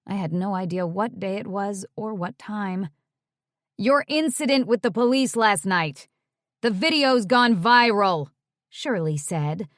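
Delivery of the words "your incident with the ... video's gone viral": too loud, needlessly blunt. Acted. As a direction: announce it louder than needed. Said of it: "louder than was either necessary or nice".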